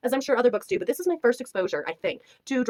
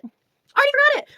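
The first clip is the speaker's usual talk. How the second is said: much higher, louder exclamation; incredulous repeat; 6 dB louder than usual. Real, not acted. Reviewer repeats she forgot his name, incredulous and high. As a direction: pitch way up in disbelief.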